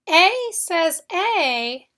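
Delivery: A says A drawn out slowly, both A long; slow, stressed A. Shown as a demonstration. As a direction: stretch each A slowly. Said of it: "at the end of a syllable".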